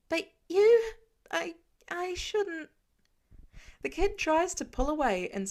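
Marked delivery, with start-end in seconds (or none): 0.1-2.7 high, loud, then slow frightened stammer; panicked stammer. A narrator's reading. Frightened kid stammers at Tony, high and panicked. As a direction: blurt it out and back away.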